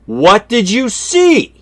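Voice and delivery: insistent repeat. Retold or imitated. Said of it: "acted repeat of the question".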